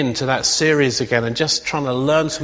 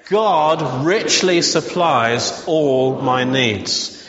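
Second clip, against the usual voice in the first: slow declaration; much slower than usual. Shown as a demonstration. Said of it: "Just say that again".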